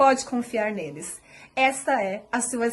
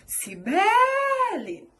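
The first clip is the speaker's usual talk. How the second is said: high CIBELE with a long-held BE; name called out, middle syllable BE prolonged; calling out, stretched BE; 9 semitones higher, 4 dB louder, much slower than usual. Shown as a demonstration. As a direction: call "Cibele" as if summoning her, BE drawn out. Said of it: "Se fossem chamar por mim".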